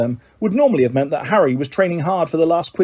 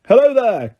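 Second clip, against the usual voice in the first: cheery call; 7 semitones higher, 4 dB louder than usual. A narrator's reading. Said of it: "called a cheery voice".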